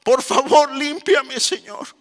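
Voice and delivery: high, impassioned begging to God; acted desperate prayer. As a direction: plead urgently as a repentant believer, fading at the end. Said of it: "Diciéndole".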